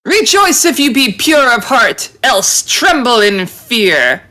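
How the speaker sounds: high, grand declamation, slowing at the end; heroic proclamation. Acted. Heroine warns the beast after striking it, high grand proclamation. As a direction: declaim it like a champion of good.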